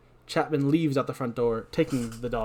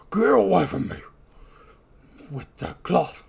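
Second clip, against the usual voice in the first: lightly voiced revived corpse; dead man's voice; 5 semitones higher than usual. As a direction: come to as the walking dead. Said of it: "This is my dead voice".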